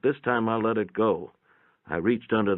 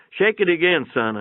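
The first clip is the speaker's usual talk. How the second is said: put-on high, loud call to the boy; impatient shout to the boy holding the light; louder call; 9 semitones higher, 4 dB louder than usual. A narrator's reading. Calling to boy again.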